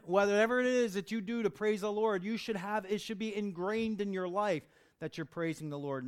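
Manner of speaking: loud, rising preacher's exhortation; fervent preaching. Acted. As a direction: drive it home to the congregation. Preaching at full force.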